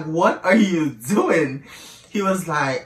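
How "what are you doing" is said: drawn-out, higher indignant question. Retold or imitated. Woman retelling how she challenged her bedmate on waking, indignant. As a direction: stretch out a retold challenge in disbelief. Startled awake, challenges him.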